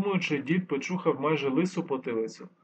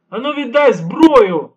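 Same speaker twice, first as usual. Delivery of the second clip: slow, much louder, higher furious yell; angry shout; 8 semitones higher, 15 dB louder, slower than usual. A narrator's reading. Guard shouting at the old man, angry. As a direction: bellow the demand for the weapon. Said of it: "гнівно закричав один з гвардійців".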